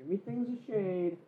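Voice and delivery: barely audible, very soft repeat; tiny hushed voice; hushed echo. Acted. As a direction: echo it faintly, as if far away. Same phrase echoed very softly.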